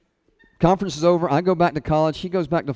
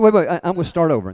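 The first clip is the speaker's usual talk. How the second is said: fast, louder flustered restart; flustered rush; 3 semitones higher, 8 dB louder, much faster than usual. Retold or imitated. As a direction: scramble to take it back. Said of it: "I said".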